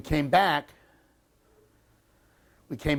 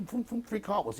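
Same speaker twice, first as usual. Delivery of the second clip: usual speaking voice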